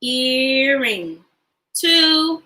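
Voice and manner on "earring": "Earring" stretched into two long parts; syllable split. Shown as a demonstration. Teacher counting syllables for the class, syllable split. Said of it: "How many syllables does earring have?".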